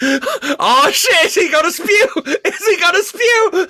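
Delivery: squealing with laughter; words breaking up in high laughter; laughing too hard to get the words out. Real, not acted. Laughing fit over lawnmower man joke.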